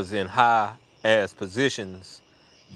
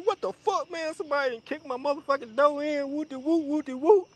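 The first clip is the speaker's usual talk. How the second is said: high-pitched scream without real volume; hammed-up high squawking rant; mock scream. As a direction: imitate an outraged friend, pitched way up. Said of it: "he fucking, like, scream".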